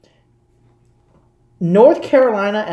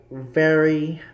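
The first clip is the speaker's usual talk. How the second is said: ordinary talk, nothing marked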